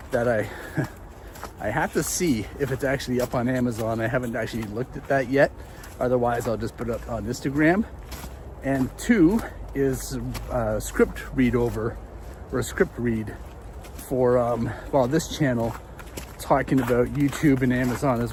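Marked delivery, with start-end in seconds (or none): none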